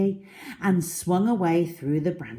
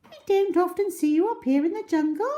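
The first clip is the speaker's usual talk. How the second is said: very high, lilting bird call; roly-poly bird singing hello; sing-song bird; 4 dB louder, faster than usual. Acted. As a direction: chirp a cheerful greeting. Bird chats to crocodile.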